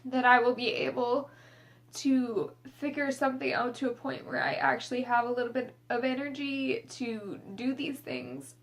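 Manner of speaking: fighting back tears between long pauses; tearful. Real, not acted. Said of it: "really hard for me to say that".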